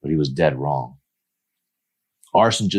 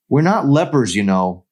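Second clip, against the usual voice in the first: higher, louder angry retort; a sharp, wounded snap; seething; 8 semitones higher, 5 dB louder than usual. Acted. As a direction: bite back at the nurse. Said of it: "Emery seethed".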